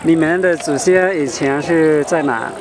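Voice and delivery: ordinary delivery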